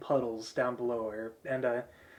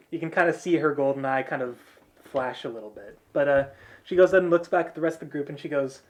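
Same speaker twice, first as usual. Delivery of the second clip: ordinary talk, nothing marked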